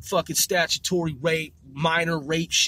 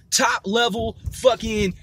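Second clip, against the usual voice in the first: normal talking voice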